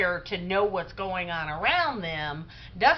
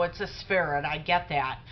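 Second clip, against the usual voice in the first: ordinary delivery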